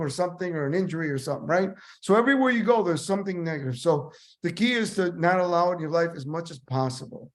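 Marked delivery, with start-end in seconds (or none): none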